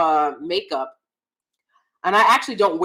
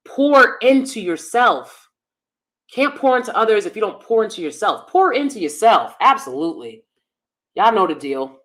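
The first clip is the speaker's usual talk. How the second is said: normal talking voice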